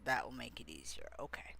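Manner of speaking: fast, low whisper to self. Real, not acted. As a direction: whisper it under your breath. Muttering to self while arranging.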